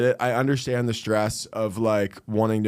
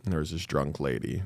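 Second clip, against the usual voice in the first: smug podcast comic; 8 semitones lower than usual. Retold or imitated. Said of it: "I go on podcasts, like".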